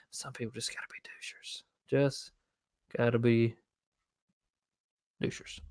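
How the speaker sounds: whispering. Real, not acted. Whispered aside to himself.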